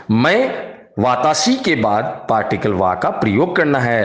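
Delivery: ordinary talk, nothing marked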